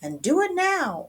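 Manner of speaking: high, loud scolding; ordering mother. A narrator's reading. Mama orders her kitty boy to return bones, firmly. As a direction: lay down the law as Mama. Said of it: "ordered Mama".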